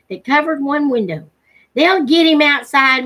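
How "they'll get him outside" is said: Ma Forester. Acted. Ma Forester voiced in the hunt.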